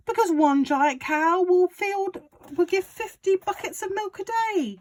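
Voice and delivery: high, loud, gleeful father; excited Mister Cranky. A narrator's reading. Father urging George on about the medicine, high and loud. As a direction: crow it like a man with a scheme. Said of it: "cried Mister Cranky".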